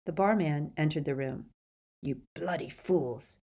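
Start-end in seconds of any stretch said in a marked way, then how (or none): 2.0-3.4 slow, gruff scolding; understated gruff rebuke. A narrator's reading. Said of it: "he said gruffly".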